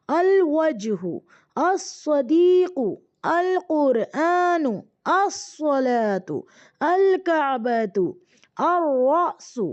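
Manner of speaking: each Arabic word read slowly, drawn out; slow careful Arabic reading; slow exercise reading. Shown as a demonstration. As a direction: read the list word by word for the class. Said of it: "अब आपने देखना है यहाँ पर".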